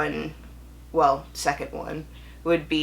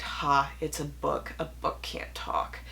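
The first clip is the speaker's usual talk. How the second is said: a quick whispered aside, much softer; 3 semitones lower, 7 dB quieter than usual. Real, not acted. Booktuber whispering a self-correction to viewers, softer. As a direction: drop to a whisper and correct yourself.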